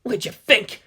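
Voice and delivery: scornful retort; spat. A narrator's reading. Nerve officer spits a scornful answer at Delta One. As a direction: spit it back at him. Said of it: "the nerve officer spat".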